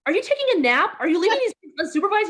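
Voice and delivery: high, loud, fast mock scolding; indignant wife scolding a lazy husband. Wife scolding husband napping under pillows, high and loud.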